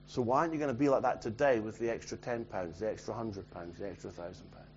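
low, soft, fading almost to nothing; hushed challenge. Real, not acted.

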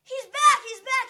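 high, loud joyful shout; excited cheering. Retold or imitated. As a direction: cheer like a crowd. Crowd cheers at wedding.

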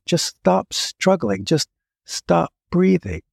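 slow, high, sweetly coaxing. Retold or imitated. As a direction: lull him gently, slowly, up high. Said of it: "the sweetest voice imaginable".

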